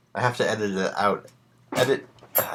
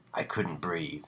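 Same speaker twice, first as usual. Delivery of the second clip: ordinary delivery, nothing marked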